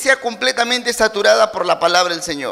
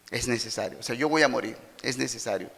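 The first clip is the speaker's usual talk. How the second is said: hushed solemn; 10 semitones lower, 9 dB quieter than usual. Retold or imitated. Voz de Jesús, solemne.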